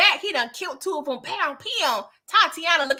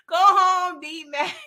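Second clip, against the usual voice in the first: laughing through the words; giggling it out; 7 semitones higher, much slower than usual. Real, not acted. Vlogger mocking the character while laughing.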